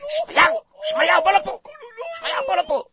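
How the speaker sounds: a mother calling her son from the women's gallery in a high voice. Acted. (קריאה גבוהה של אם לבנה)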